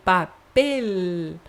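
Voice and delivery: "papel" with a crisp final L, tongue held up; teacher modelling the L sound; exaggerated final L. Shown as a demonstration. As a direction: finish "papel" on the tongue-tip L. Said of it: "você tem que colocar a língua aqui".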